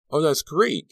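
high, loud burst of relief; delighted cry. A narrator's reading. Student hearing his friend left the coma, delighted cry. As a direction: cry out at wonderful news. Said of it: "Carrie cried".

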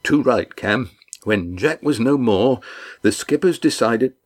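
a big man delighted to see friends; hearty fisherman. Acted. Red Ian greeting the crew, hearty and glad.

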